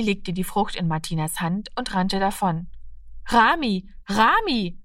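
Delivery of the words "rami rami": calling Rami after him; girl calls after a running boy, mildly raised. A narrator's reading. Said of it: "rief sie ihm nach".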